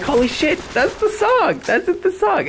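high excited squeal of recognition; surprised excitement. Retold or imitated. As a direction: blurt it out amazed, pitch way up. Realizing the movie line is the song.

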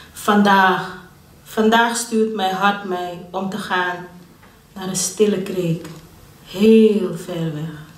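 measured poetry reading with long pauses between phrases; unhurried spoken verse with dramatic swells; poem recitation. Acted. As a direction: recite the poem deliberately, lingering on key words, swelling then easing.

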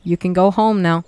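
loud blunt send-off; loud dismissal. Acted. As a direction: boom it out to send them off. Outro joke.

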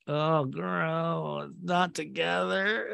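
whiny, drawn-out dismay; slow mock-tearful whine. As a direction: groan it out, pitying and exaggerated. Mock dismayed reaction to the show.

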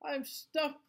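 high panicked skeleton cry; scared cry. Acted. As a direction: cry out trapped. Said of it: "cried the skeleton".

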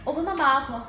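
lightly voiced father asking; a hint of a father questioning his child; father's question. Acts out father's first question.

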